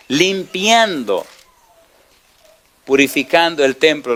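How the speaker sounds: slow, weighty preaching; dramatic slow emphasis. Real, not acted. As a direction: savour each word. Preacher dramatizes key word.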